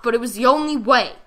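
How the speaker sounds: ordinary delivery, nothing marked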